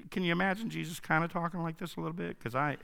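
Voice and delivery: usual speaking voice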